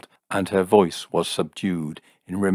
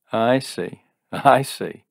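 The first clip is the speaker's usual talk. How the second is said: higher, chuckled realization; amused, laughing; 6 semitones higher, 4 dB louder, slower than usual. A narrator's reading. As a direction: say it with mocking amusement. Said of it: "Jim laughed".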